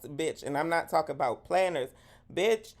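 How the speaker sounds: usual speaking voice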